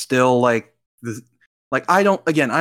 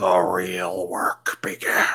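dramatic whisper; 5 semitones lower, slower than usual. Pretend. Post-credit scene teaser read dramatically.

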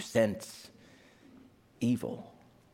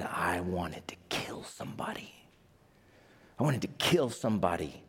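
hushed confession; 7 semitones lower, 5 dB quieter than usual. Acted. Peter confesses in first person.

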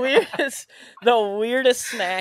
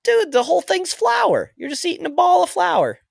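incredulous; 4 dB louder than usual. Real, not acted. Protesting about dry cookies.

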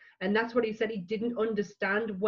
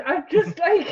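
overwhelmed; 9 semitones higher, 9 dB louder than usual. Real, not acted. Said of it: "my eyes are, like, filling up".

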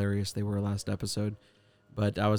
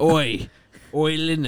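louder Cockney imitation sliding toward Australian; Cockney attempt; 3 semitones higher, 5 dB louder than usual. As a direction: echo a Cockney call, slightly off. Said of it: "Mine gets, like, Australian".

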